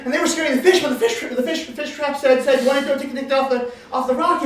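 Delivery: high, loud stumbling account; flustered complaint. Acted. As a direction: blurt it out, tripping over words. Fisher gives his panicked report.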